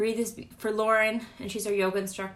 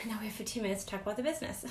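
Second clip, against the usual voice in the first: rushed, fast, laughing a little; talking fast; 6 dB quieter, much faster than usual. Real, not acted. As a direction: rattle it off quickly. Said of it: "I've been, like, talking so fast".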